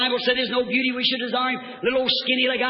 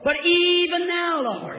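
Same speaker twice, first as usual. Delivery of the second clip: soaring pulpit refrain; preacher's faith cry; 6 semitones higher, slower than usual. Acted. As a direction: stretch it high, then fall away. Same refrain.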